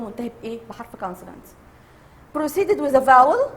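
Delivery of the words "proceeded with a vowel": loud rhetorical question, rising high on "vowel". Shown as a demonstration. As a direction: pose "vowel" as a doubtful option, loud and lifted. Teacher poses a wrong option.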